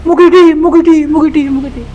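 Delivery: loud high call down the well. Acted. Man calling his friend's name down a sacred well, loud and high. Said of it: "memanggil teman, nama temannya".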